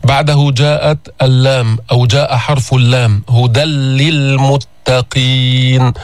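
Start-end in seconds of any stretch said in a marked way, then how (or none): 3.3-5.9 هدى للمتقين with the nun fully merged into a doubled lam; full merge into lam. Shown as a demonstration. Teacher reciting هدى للمتقين to show the nun vanish into a doubled lam. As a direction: recite هدى للمتقين slowly, tanween swallowed into a stressed لل. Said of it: "هل تسمع غنة؟".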